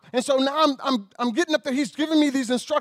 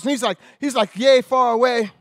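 ordinary delivery

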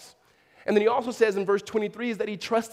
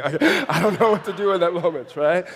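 loud, laughing admission; 7 dB louder than usual. Real, not acted. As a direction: laugh while getting the words out. Amused at his awkward parenting moment.

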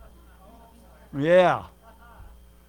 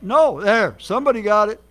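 louder; calling out; 8 dB louder than usual. Real, not acted. Preacher calling out to someone in the congregation, louder. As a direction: point and call to the crowd.

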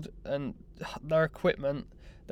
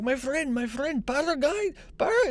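much higher, mock pushy tout voice; imitating the Turkish guy; 12 semitones higher than usual. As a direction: ham up the eager local stumbling over the word. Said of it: "he couldn't even say it".

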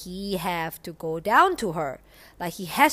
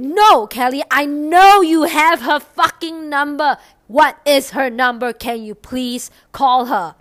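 loud, high, exasperated demand; insistent shouting; 7 semitones higher, 12 dB louder than usual. Retold or imitated. Man demanding his girlfriend's number from Kelly, insistent shouting. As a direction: imitate an angry caller pushing hard. Caller insists, retold quarrel.

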